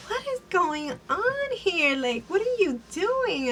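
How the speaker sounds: high, slow, incredulous mock question; shocked disbelief. Candace sees the drugs laid out, high slow disbelief. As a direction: ask it in wide-eyed shock. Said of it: "Candace's like".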